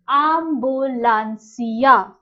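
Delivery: ambulancia drawn out syllable by syllable; teacher modelling a new word; slow and clear. Shown as a demonstration. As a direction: spell it out slowly with your voice. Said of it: "I'll repeat it for you".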